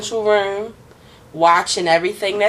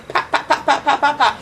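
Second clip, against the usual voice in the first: whispered popping gunshots; gunshot sounds; 6 semitones higher than usual. Retold or imitated. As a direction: spit out pop pop pop. Imitating gunshots.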